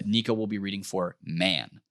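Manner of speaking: ordinary talk, nothing marked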